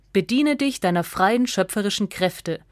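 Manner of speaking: usual speaking voice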